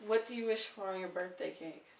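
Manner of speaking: normal talking voice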